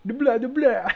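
high, loud vampire blah; hammed-up vampire catchphrase; Dracula. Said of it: "It's fucking Dracula".

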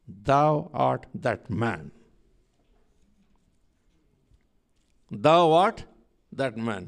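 slow, grave prophet's accusation; solemn accusation. Retold or imitated. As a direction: lay the charge down slowly and gravely. Prophet confronts King David.

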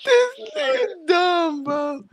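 laughing shout. Real, not acted. Laughing after the joke.

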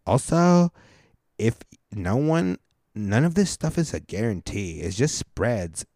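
exaggerated dim texter, dragging the words; mock idiot voice. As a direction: read it out like an idiot. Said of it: "I try to read it like an idiot".

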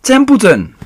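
high, loud, pleading cry; desperate. A narrator's reading. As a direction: beg with everything at stake. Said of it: "said Wilbur in desperation".

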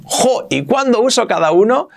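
high-pitched mock student protest; imitated student complaint. Teacher imitating students asking when to use each verb, mock complaint. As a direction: voice a puzzled learner's question, pitched well up. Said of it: "Y vais a decir".

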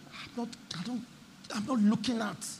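ordinary talk

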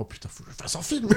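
high, breathy amazed outburst; excited exclamation. Retold or imitated. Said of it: "il a fait".

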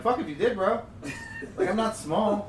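high, loud disbelief, laughing; incredulous. Retold or imitated. Disbelieving protest to friend.

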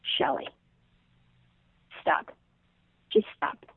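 hushed inner voice, almost a whisper. Retold or imitated. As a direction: quietly tell yourself to stop, slow and soft. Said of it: "I hear within".

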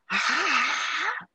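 croaky, half-asleep voice from the grave; lightly imitating a groggy ghostly voice; Laura Bozzo voice from beyond the grave. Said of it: "con voz de ultratumba tipo Laura Bozzo".

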